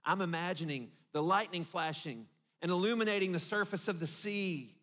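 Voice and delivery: ordinary delivery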